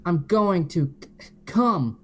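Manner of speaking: high stammered yell; hammed-up climax stammer; stuttering yell. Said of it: "Shadow yelled".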